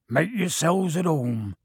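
big friendly giant voice; Hagrid voice. A narrator's reading. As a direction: welcome them like a gentle giant. Hagrid welcoming them.

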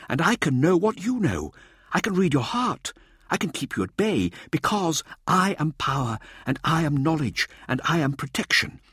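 high, intense, declaiming voice; fierce Mirza. A narrator's reading. Showboater speaks for the guardian eye to a colleague, fierce and high.

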